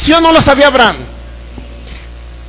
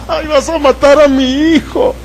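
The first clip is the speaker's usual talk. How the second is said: hammed-up fake lament, a bit higher; mock crying; 4 semitones higher than usual. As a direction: whine like a weeping father, mocking the idea. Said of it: "A ver, llora, Abraham". (llanto fingido y burlón)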